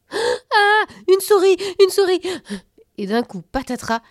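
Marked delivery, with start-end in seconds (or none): 0.1-2.2 much higher, louder shriek of fright; frightened tooth fairy shrieking at a mouse; terrified scream. Acted. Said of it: "elle hurla".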